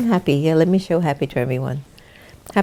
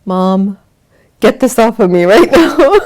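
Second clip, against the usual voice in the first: higher, louder bratty demand; dog's demand; 7 semitones higher, 11 dB louder than usual. Retold or imitated. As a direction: play the dog throwing a fit.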